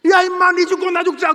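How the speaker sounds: high, loud furious yell; enraged parent. Retold or imitated. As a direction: shout it like a parent at the end of his rope. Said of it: "그럼".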